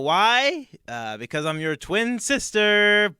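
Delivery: high, loud hammed-up soap twist; mock dramatic reveal. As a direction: build to a big, drawn-out fake shocker.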